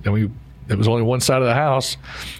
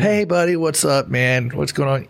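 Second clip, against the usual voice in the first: higher, bright-voiced friendly hello; cheery greeting; 3 semitones higher than usual. Salesman mimics the customer's cheery phone greeting. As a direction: greet him like an old buddy. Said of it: "The guy was just wonderful to talk to".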